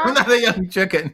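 laughing through the words. Real, not acted. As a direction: chuckle out the line. Repeats, laughing.

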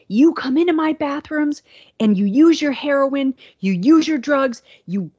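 hammed-up angry clerk tirade; imitating a clerk ranting at a supposed addict; mock rant. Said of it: "this was a rant".

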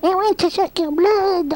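very high falsetto vampire; comically unscary high-pitched menace; squeaky Dracula. Shown as a demonstration. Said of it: "if Dracula had a voice like this".

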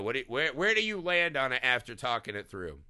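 ordinary delivery

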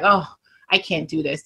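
low, soft, mildly deflated; defeated self-talk. Retold or imitated. Agent doubting herself before a live, mildly defeated. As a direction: lightly act out giving up.

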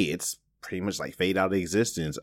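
ordinary talk

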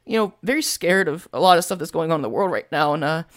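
voicing the scared young NPC Darius; scared young boy. Shown as a demonstration. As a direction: pitch it up like a nervous sixteen-year-old kid. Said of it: "he talks, uh, like this".

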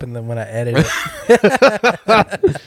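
laughing. Real, not acted. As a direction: break up laughing at the end. Laughing while talking.

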